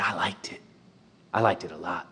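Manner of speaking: hushed, breathy confession; whisper. Retold or imitated. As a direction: whisper it softly, moved. Tender memory of family dinner.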